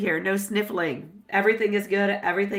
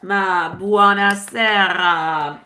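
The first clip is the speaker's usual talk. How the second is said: drawn-out greeting; 8 dB louder, much slower than usual. Real, not acted. Greeting a sitter in Italian.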